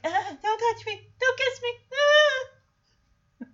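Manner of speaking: high squealy talking-dog voice; high-pitched mock protest, ending in a squeal; reluctant dog. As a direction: voice a dog recoiling from a kiss. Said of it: "the dog's like".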